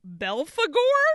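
high, loud questioning name; rising disbelief on an odd name; puzzled. Retold or imitated. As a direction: read it as a baffled question. Puzzled reading of own notes.

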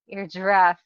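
ordinary delivery

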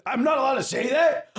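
high loud protest; indignant shout. Real, not acted. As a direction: shout it in outrage.

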